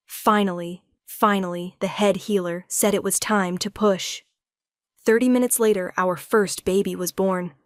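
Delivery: usual speaking voice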